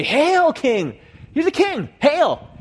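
loud, high-pitched mock cheers; mocking acclaim. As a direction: shout it like soldiers jeering a fake king. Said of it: "here the soldiers are mocking Jesus".

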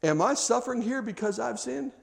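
mildly acted anguish; tearful plea. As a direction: plead in a small, wounded voice. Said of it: "weeping and bleeding and crying and maimed".

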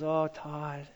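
soft and gentle, hushed down; gentle shepherd voice, quiet and tender; gentle God voice. Pretend. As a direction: tell him kindly, almost a whisper. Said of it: "he goes".

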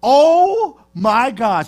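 long, loud amazed "oh my gosh"; drawn-out oh my gosh. Real, not acted. Pastor to congregation, amused disbelief, drawn-out and loud. Watching wild board meetings.

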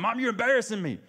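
mildly hammed-up teen calling from upstairs; embarrassed son hollering. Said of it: "Junior's, like, hollering down".

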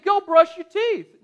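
loud, slow barked order; yelled. Retold or imitated. Father yelling at kids to brush teeth, loud and slow. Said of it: "Not that nicely".

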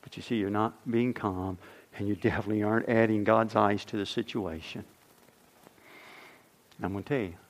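ordinary delivery

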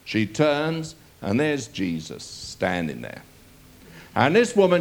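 normal talking voice

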